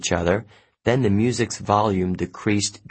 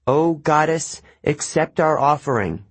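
solemn prayer; 6 semitones higher than usual. Acted. Annabeth prays to a goddess.

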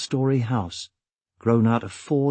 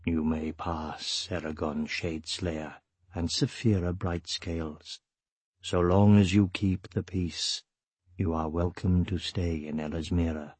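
deep, calm voice; 6 semitones lower, 7 dB quieter than usual. A narrator's reading. Gildarian granting the travellers leave to enter, deep and calm. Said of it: "A deep, calm voice sounded".

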